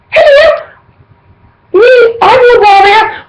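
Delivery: very high, squealed fan reply; eager calling back. Retold or imitated. Fan replying to the frontman's call, eager high cry. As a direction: answer the stage in a high, excited call. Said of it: "And I'm like".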